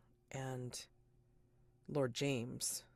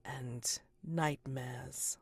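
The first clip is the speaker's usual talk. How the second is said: grand, drawn-out proclamation, swelling then easing slower; echoing villainess Titania. Acted. Titania's echo addresses the guests after vanishing, grand and drawn out. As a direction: stretch the words out like a ceremonial host foretelling doom. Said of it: "echo in her voice saying".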